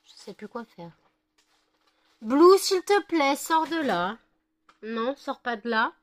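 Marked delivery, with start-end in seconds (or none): 2.2-5.9 high, slow begging; pleading with dog. Real, not acted.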